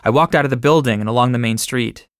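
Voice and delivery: ordinary delivery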